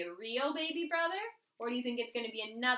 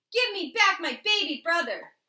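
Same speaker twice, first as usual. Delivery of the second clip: loud demand; 5 semitones higher, 10 dB louder than usual. A narrator's reading. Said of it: "She said".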